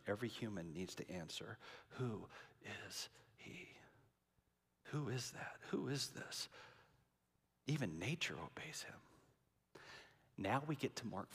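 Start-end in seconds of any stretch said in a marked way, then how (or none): 2.0-9.0 soft, hushed wonder; awed whisper. Retold or imitated. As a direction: breathe the question in awe. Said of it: "they say the question".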